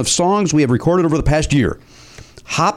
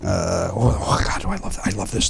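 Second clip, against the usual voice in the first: hushed delight; 8 semitones lower, 5 dB quieter than usual. Real, not acted. Gushing over the opened envelope.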